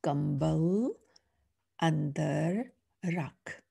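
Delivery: andar rakh drawn out slowly, word by word; slow reading. Shown as a demonstration. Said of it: "now let's read the sentence".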